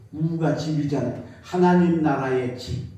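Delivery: normal talking voice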